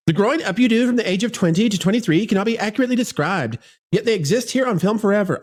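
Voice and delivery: fast put-on young actress accent, rattled off; Rachel Zegler accent. Host mocks actress's statement in her accent, fast and put-on. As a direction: rush through it in a mocking starlet accent. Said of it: "I can't do that accent that long".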